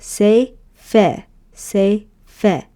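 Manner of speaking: teacher chaining say fe; syllables say fe. Shown as a demonstration. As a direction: say and fe in careful rhythm. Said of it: "And so you have".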